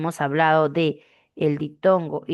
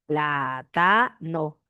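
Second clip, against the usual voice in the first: pla-ta-no, slow, one clap per syllable; clapped syllables; much slower than usual. Shown as a demonstration. Teacher claps out plátano for the class, slowly split. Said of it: "Todos con sus palmas".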